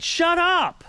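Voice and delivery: high-pitched, exasperated command; retold yell. Finally silencing the ranting neighbor, retold yell. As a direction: push it up high like a yell at moderate volume. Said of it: "me yelling "shut up".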